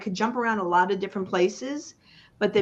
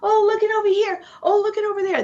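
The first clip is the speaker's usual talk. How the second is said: much higher, bright mock distraction, fast at first; distracted flighty voice; 12 semitones higher, 6 dB louder, faster than usual. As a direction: play someone chasing every shiny thing. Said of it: "it's really easy to go".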